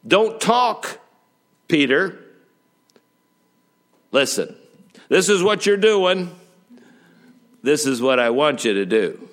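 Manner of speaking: emphatic voice of authority, in short bursts; acted stern command. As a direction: lay down the law to a disciple. Acted divine command to Peter.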